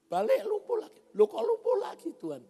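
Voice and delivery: high, loud cry of disbelief; alarmed surprise. Acted. Preacher voicing his past self to God, high and loud in shock. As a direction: yelp it out, shocked all over again.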